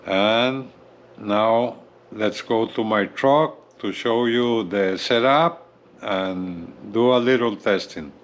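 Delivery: ordinary delivery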